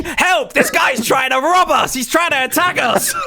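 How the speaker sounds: high, panicked mock scream; screaming for help. Pocket crying robbery to the street, high mock-panicked screaming. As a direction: raise a hammed-up alarm. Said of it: "then Pocket starts screaming".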